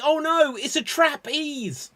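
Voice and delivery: high, loud hammy panic; mock panic. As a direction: cry out in comic alarm. Fleas panic at insecticide.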